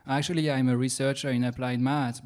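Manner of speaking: usual speaking voice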